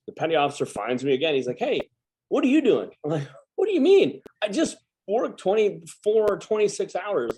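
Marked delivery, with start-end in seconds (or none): 1.6-2.9 higher, sharper voice of a petty officer catching someone; hammed-up higher officer challenge; mock bossy officer. Petty officer catches tired sailor. 3.6-7.4 much higher, disbelieving whine, slowing on the hours; mock indignant protest. Exhausted sailor protests third shift.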